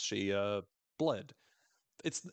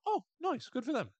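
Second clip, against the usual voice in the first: much higher cheerful approval; pleased and sweet; chirpy Travis. Retold or imitated. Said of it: "being like".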